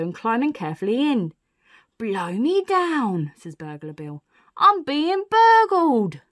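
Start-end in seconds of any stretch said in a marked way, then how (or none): 2.0-3.3 loud, very slow astonishment; storybook burglar taken aback; astonished exclamation. Acted. Burglar realises he is being robbed. 4.6-6.2 high, loud, long-drawn cry; outraged storybook burglar; shocked outcry. Acted.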